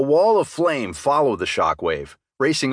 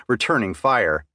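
ordinary delivery

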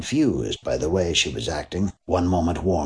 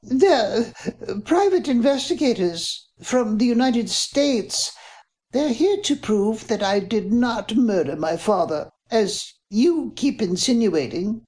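high, thin voice with a touch of a tremble; a young woman trying to sound brave but faltering; high, slow-ending mildly shaky protest; quavering and weak; 13 semitones higher than usual. Acted. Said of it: "her voice came out all quavering and weak".

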